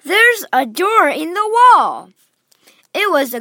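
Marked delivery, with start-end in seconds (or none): 0.0-2.1 high, loud and slow with discovery; excited cry. Acted. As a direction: announce the find eagerly. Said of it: "cried Charlie".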